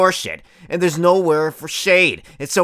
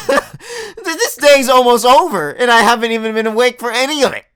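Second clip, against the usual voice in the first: laughing whine; 8 semitones higher, 5 dB louder than usual. Acted. Laughing lament about oversleeping.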